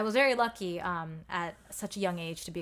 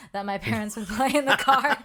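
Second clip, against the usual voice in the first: louder, laughing mid-sentence; 4 semitones higher, 10 dB louder than usual. Real, not acted. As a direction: let a laugh break into the line. Laughing remembering parents' cassettes.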